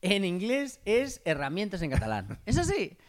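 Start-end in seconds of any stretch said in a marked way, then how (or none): 2.5-3.0 excited. Real, not acted. Excited punchline to friend.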